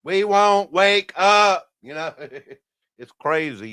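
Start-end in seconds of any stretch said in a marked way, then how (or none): 0.0-1.7 slow, louder mock stubborn refusal, pitched higher; stubborn sheeple. Streamer mimics people refusing to wake up, slow mocking voice. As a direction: drag it out like a stubborn crowd refusing. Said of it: "they're like".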